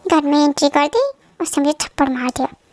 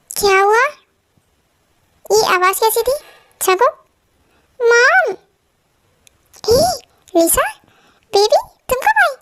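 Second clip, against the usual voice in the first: ordinary delivery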